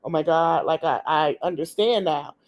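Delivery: a hint of someone else's subdued voice; retold realization. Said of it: "we gonna just say it like this".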